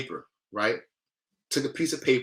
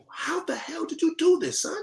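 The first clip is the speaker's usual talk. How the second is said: acting out a dad amazed by his son; astonished father. Story father amazed at son's puzzle.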